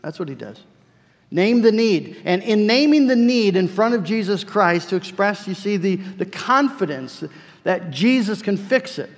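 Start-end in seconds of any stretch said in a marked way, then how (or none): 1.3-9.1 loud, high, driving preacher's cry; impassioned preaching. Acted. Preacher urging his congregation, loud and high. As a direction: raise the roof on the point. Preacher builds to full force.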